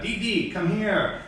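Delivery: put-on high, slow, playful call to a kid; calling playfully. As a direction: call the boy over teasingly. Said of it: "I'll call a boy Didi".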